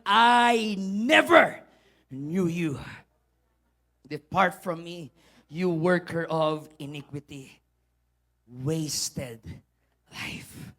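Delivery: low, slow words sinking to near whisper; solemn pronouncement. Acted. The Lord dismissing false followers, grave and hushed.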